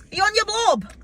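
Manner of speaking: high, loud attempt at a Scottish accent. Quoting Billy Elliot to co-host, loud Scottish accent. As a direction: belt out the film quote in would-be Scottish. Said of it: "said it in a Scottish accent".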